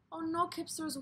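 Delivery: usual speaking voice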